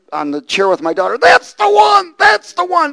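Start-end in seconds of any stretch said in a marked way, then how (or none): 1.2-2.9 high excited shout; pointing out the lizard on TV, shouting; excited yelling. Retold or imitated. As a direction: yell it with recognition. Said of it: "I'm yelling on the chair".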